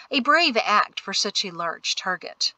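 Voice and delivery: ordinary delivery, nothing marked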